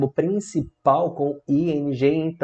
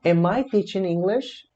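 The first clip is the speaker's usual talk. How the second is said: "Am I teaching English" slower, pitched high as a question; questioning intonation; 6 semitones higher, slower than usual. Shown as a demonstration. Teacher questioning themself, "Am I teaching English" with rising question tune. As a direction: lift "English" into a slow question. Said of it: "Agora eu vou me questionar".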